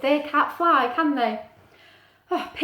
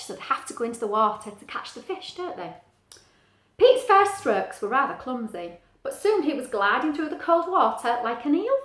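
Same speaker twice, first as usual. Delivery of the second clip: usual speaking voice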